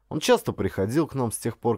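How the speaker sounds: usual speaking voice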